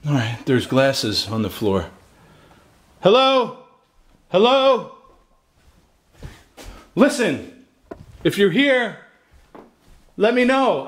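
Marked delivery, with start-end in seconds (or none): 3.0-4.9 high, loud call into the dark. Real, not acted. Calling into empty rooms. 6.9-10.9 high, loud plea to the empty house; pleading call. Real, not acted. Pleading with possible ghost.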